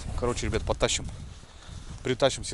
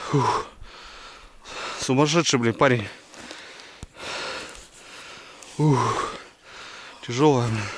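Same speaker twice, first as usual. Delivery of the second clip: out of breath; 4 dB louder than usual. Real, not acted. After hauling log.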